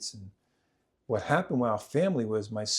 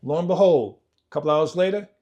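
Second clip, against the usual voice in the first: ordinary delivery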